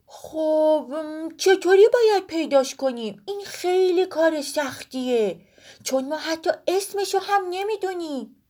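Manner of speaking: put-on high, pondering boy's voice; thoughtful boy. Woolak wonders how to find the sister, high and pondering. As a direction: think it through, unsure.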